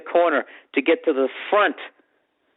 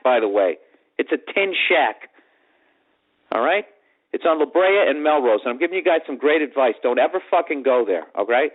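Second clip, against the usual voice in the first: ordinary talk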